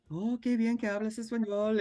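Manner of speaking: high, pleased lightly voiced shop lady; a delighted lady's voice, lightly played; bakery lady. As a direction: imitate the friendly baker praising him. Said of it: "she was just like".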